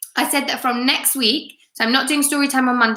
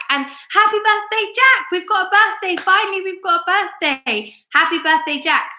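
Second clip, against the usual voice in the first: much higher, delighted birthday cheer; excited birthday greeting; 8 semitones higher than usual. Real, not acted. Teacher wishing Jack happy birthday on a livestream, excited and high. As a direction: celebrate the pupil's birthday with big excitement.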